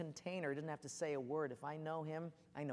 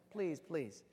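ordinary delivery

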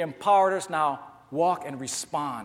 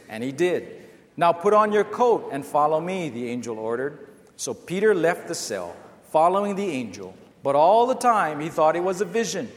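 louder, measured scripture reading in unison; congregational reading aloud; 5 dB louder than usual. Real, not acted. Pastor leading the church in reading Jeremiah, loud and measured. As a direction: read it out together like a congregation. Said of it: "Let's read together what that next scripture says".

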